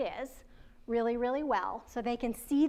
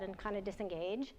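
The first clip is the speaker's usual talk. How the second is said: ordinary talk, nothing marked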